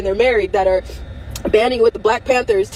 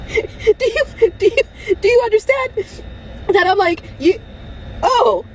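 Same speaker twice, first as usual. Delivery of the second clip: tearful plea; 11 semitones higher, slower than usual. Real, not acted. Pleading through tears.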